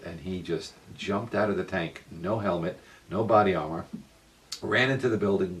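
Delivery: ordinary delivery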